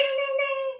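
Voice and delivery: high sung ding ding ding; bell imitation. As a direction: ring it out like the round bell.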